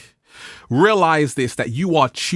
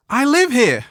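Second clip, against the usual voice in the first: indignant; 9 semitones higher, 3 dB louder than usual. Retold or imitated. Retold protest at stranger.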